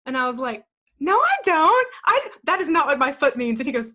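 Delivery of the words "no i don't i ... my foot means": high, loud indignant retort; defensive denial. Retold or imitated. Retold denial to husband about her kicking foot, loud and indignant. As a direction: protest hotly that it isn't true.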